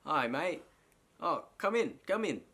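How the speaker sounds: high, loud mock-British voice; badly faked British welcome; attempted British accent. Shown as a demonstration. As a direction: have another go at a hammy British accent. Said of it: "Let me try, let me try".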